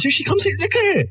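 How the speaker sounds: high squeaky lady's voice; mock Anne Hobbs. Mocking a rival presenter in a squeaky high voice. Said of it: "Shut up, Anne Hobbs".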